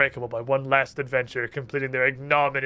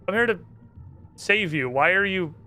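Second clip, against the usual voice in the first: ordinary talk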